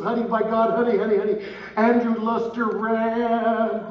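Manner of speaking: high, loud, urgent calls of honey; excited wake-up. Retold or imitated. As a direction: shake him awake with the big news. Wife wakes him with news.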